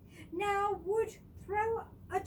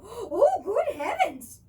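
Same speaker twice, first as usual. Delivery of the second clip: loud, high gasp of alarm; as if the tree just bent in half; shocked gasp; 4 semitones higher, 10 dB louder, slower than usual. Acted. Mr. Willoughby sees the bent tree, loud shocked gasp. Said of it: "he gasped".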